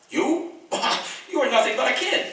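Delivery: scoffing disbelief with a laugh; incredulous laugh. Acted. Scoffs at girl's request.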